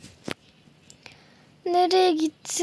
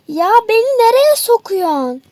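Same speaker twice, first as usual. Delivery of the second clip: high, louder little-creature pleading; a tiny bear squealing to be let go; squeaky captive cry; 5 semitones higher, 5 dB louder than usual. Acted. Captive being stuffed away.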